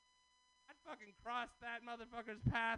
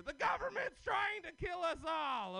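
mock rant; 9 semitones higher, 5 dB louder than usual. Conspiracy rant, shouting.

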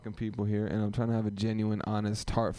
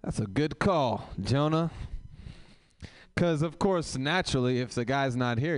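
sarcastic praise; 4 semitones higher than usual. Real, not acted. Host mocks the sign-up list.

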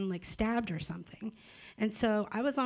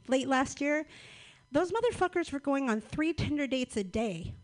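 normal talking voice

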